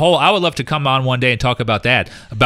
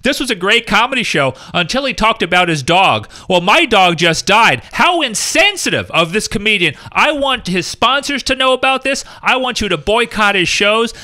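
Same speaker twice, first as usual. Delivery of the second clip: rising, hammed-up indignation; mock outraged tweeter; 8 semitones higher than usual. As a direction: parody a whiny complainer online. Mocking outraged Twitter complainer.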